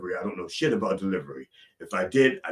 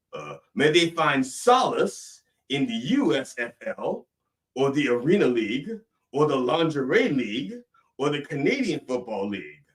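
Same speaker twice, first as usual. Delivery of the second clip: raised, rolling pulpit cadence; mock preacher's prayer; 6 semitones higher, 4 dB louder than usual. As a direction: intone each item like a preacher's litany.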